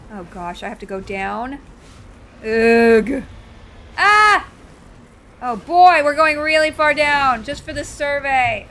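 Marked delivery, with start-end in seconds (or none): 2.4-3.2 loud, exasperated ugh; big groan at the game; frustrated groan. Real, not acted. Stuck driving down in game. 4.0-4.5 loud, high yelp; sudden high cry of alarm; startled yell. Real, not acted. Vehicle drops in game. 5.4-8.7 loud, slow, drawn-out alarm; loud dismay on the way down; alarmed exclamation. Real, not acted. Alarmed as vehicle descends far.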